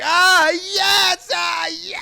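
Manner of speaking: very high shouted cheer; mock hyped cry. Retelling Elliot fake crying turning hyped, high whoop. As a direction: whoop it like fake tears turned joy. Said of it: "more hyped".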